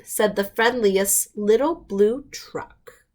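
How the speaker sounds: ordinary delivery, nothing marked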